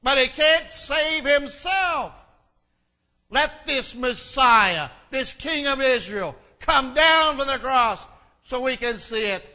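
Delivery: mocking challenge, pushed hard and high; scoffing shout. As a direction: taunt him loudly before the crowd. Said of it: "they scoffed".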